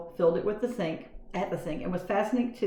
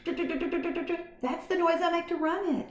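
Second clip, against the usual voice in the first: squeaky mouse; 9 semitones higher, slower than usual. A narrator's reading. Mouse voiced.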